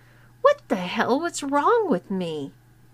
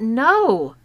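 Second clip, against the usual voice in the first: sudden loud blurt; a quick, loud protest at the door; 7 dB louder than usual. Retold or imitated. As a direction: let the no burst out of you. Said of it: "I actually blurted out".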